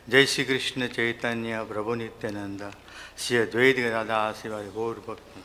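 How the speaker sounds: rapid chanted Sanskrit prayers, fast; prayer recitation. Acted. Devotee opening his offering to Prabhupada, quick prayer chant. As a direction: rattle off the pranam mantras by heart.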